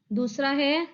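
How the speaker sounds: ordinary talk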